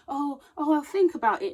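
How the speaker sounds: mock dithering excuse. Mocking an evasive excuse.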